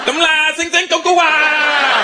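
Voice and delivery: high, slow sing-song gibberish; hammed-up mock Chinese. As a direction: fake Chinese phone call, pitched high. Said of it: "I talked to my wife".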